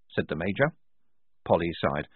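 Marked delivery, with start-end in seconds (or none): none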